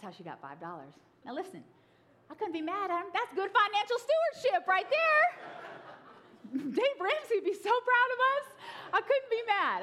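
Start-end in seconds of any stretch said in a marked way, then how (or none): 2.3-9.8 laughing. Real, not acted. Laughing comic aside.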